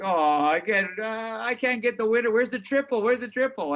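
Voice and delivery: high, loud panicked whine about missing the triple; freaking out. Retold or imitated. To the listener, retelling his stock panic, high loud frantic whine. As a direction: panic over the missed winner, voice jumping high. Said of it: "And I'm freaking out".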